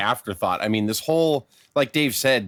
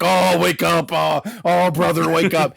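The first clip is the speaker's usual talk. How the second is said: mock Hulk begging her to wake, a bit higher; hammed-up frantic Hulk; Hulk Hogan pleading; 4 semitones higher, 3 dB louder, slower than usual. Hulk begs Elizabeth to wake, mock pleading. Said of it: "where he's like".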